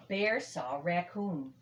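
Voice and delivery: usual speaking voice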